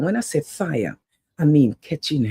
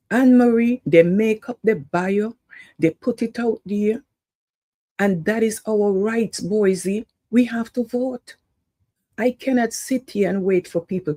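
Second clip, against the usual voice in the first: preaching to the crowd; 7 semitones higher than usual. Real, not acted. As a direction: rouse the community like a street preacher. Fired-up appeal to viewers by name.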